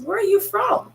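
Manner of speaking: imitating the young man's bright rising query; young man asking. Young man at Walmart asking where she's from, high curious rise. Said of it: "he says".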